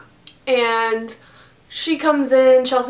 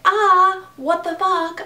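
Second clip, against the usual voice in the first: alarmed outburst; 8 semitones higher, slower than usual. Retold or imitated. Recalling the moment she saw the kitchen fire. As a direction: blurt it out in shock. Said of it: "So I'm like".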